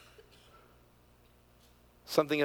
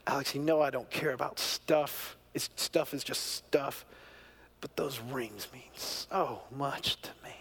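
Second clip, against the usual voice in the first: tearful plea; 4 dB quieter than usual. Retold or imitated. Imitating his tearful mother asking for her rings, softly. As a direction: gently mimic her near tears. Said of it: "she said out loud, she goes".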